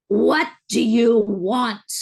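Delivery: put-on a little girl's demand, staring into her eyes, loud and slow; little girl demanding what she wants, slow and loud; plain-accented child's demand. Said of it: "No accent or anything".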